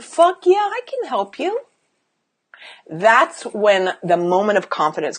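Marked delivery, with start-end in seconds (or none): 0.0-1.6 high, gleeful boast that softens; confident self-talk. Acted. Coach voicing a newly confident client, upbeat. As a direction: pitch rising into a singsong offer. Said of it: "and you're like".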